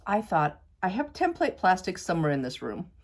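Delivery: usual speaking voice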